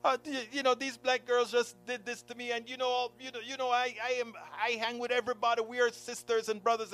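high, pleading imitation of a tearful friend. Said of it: "she's boohooing and crying".

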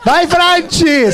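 high, slow, loud call across. Retold or imitated. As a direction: call out to him from afar. Parents calling to the wrong soldier.

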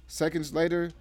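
ordinary delivery, nothing marked

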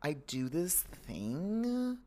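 put-on soft, slow, breathy Valley Girl drawl, trailing to a whisper; Valley Girl accent. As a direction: imitate a laid-back LA Valley Girl, unhurried and hushed. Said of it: "They're like".

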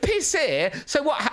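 put-on high child voice, cheeky; imitating daughter. Father voices daughter shrugging off his warning, high child voice. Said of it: "She went".

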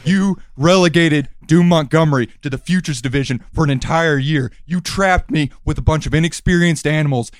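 loud, menacing heel promo; wrestling promo. Acted. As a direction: cut a threatening promo.

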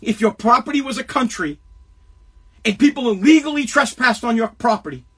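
ordinary talk, nothing marked

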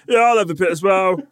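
high, tumbling eager pipe-up; eager butting in. Retold or imitated. Asking partner for a share of food, high and eager. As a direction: jump in greedily, pitched high.

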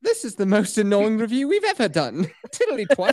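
hammed-up British, amused; mock British accent. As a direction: put on a silly British accent. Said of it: "stick with these accents".

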